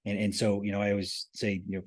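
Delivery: ordinary delivery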